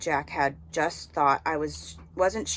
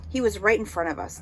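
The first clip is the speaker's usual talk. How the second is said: plain reading voice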